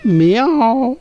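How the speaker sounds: loud, high meow like a cat; loud teacher's meow. Shown as a demonstration. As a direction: meow for the children to copy. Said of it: "प्राणियों की बोलियों की नकल करो".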